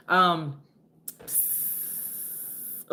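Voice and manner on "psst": whispered psst. Real, not acted. Making a sound effect.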